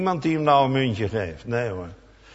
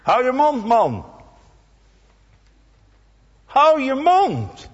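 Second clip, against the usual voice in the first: high, loud scolding rebuke; rebuking; 9 semitones higher, 4 dB louder, slower than usual. Retold or imitated. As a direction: shut him up sharply, slower on the last. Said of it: "Ze bestraffen hem".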